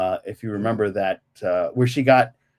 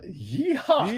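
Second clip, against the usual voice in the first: high, laughing Southern whoop; playful cowboy holler in a Southern accent; light Southern twang, laughing; mock Southern yeehaw; 11 semitones higher than usual. Joking along with Southern accents.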